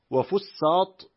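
"وفساط" with a long, doubled s; doubled sin. Shown as a demonstration. Reader lists another variant, "وفساط" with doubled س. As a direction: hold the س in "وفساط" as a geminate. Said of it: "بتشديد السين".

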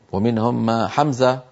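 ordinary delivery

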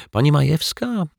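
high rising address; polite calling. A narrator's reading. Detective addressing Mrs Majewska, rising. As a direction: call her name with a lift.

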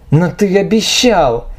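high, cracking desperate outcry; desperate cry. Acted. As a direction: scream it in despair. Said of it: "сорвалась на крик девушка".

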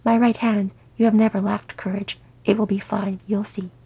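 plain reading voice

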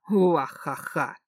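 slow hammed-up villain cackle; evil laugh. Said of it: "Сквозь зловещий смех".

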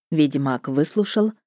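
usual speaking voice

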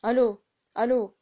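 loud, exasperated telephone hello; annoyed shout. A narrator's reading. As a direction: call into a dead phone, vexed. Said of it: "وصاح متكالف في ضيق".

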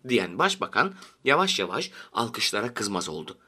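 ordinary delivery

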